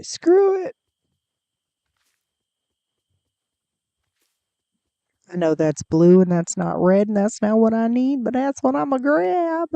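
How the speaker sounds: a hammed-up Southern accent, screw it attitude. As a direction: slip into a folksy Southern accent while grabbing flowers. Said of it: "I don't know why I'm doing a southern accent".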